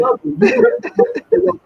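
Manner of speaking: high, slow, laughing through the words. Retold or imitated. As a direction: tease her while cracking up. Quoting himself to the woman.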